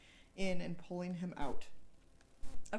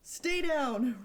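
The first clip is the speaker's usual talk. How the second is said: loud, higher commanding shout; shouted order; 6 semitones higher, 6 dB louder than usual. A narrator's reading. Cruiser shouting at Frost to stay under the desk. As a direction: yell it over gunfire.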